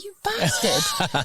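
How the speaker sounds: high, laughing you bastard; laughing curse. Retold or imitated. To the farmer who set her up, a laughing mock curse. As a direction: squeal it out through laughter. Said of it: "I'm like".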